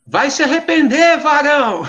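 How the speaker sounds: high, loud parting threat shout; retold yell. Stranger yelling at him from the parking lot, loud and high. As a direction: shout it after him as he leaves. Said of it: "ouvi ele gritando assim".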